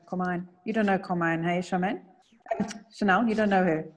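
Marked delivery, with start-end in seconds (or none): none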